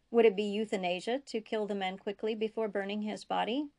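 usual speaking voice

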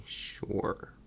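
normal talking voice

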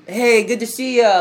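imitating a cheerful hello; real happy. Queen greeting Jon Snow at the throne, happy. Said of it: "like, real happy".

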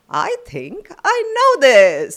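high, slow, big title call; announcer title reveal. Acted. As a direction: present the quiz name like a game show host.

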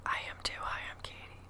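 quiet playful whisper. Real, not acted. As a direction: join in, whispering softly. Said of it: "Why are we whispering?".

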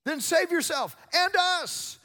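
high, loud, drawn-out jeer; hurled insult. Retold or imitated. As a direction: hurl it angrily like the mocking criminal. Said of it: "hurling their insults".

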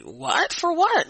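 high-pitched incredulous retort; acted-out baffled objection; indignant disbelief. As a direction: replay the protest, pitch jumping up. Retells disbelief at being sent home.

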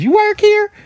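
high, loud mocking squeak of a clueless shopper; mock customer voice. Retail worker mocking a customer asking if she works there, high silly squeak. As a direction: parody the dumb question in a silly high voice.

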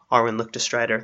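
usual speaking voice

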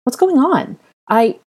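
high, questioning call that breaks off; calling, cut off. Acted. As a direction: call out, then stop dead. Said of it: "the woman's voice cut off immediately".

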